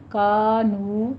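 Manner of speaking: کانو drawn out very slowly, both vowels stretched; long madd demo. Shown as a demonstration. Teacher shows correct madd after the wrong one, slow stretch. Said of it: "الف مدہ، واو مدہ دونوں ادا کرنے ہیں".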